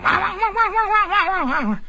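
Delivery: high mock dog yelps; yelping dog. Dog after its tail is stepped on, high yelping. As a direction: yelp like a hurt dog.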